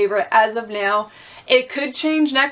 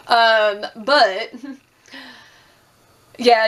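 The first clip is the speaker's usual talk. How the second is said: ordinary delivery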